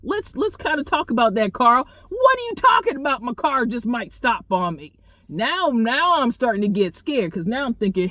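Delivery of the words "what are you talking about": alarmed disbelief. Retold or imitated. Alarmed question to Carl.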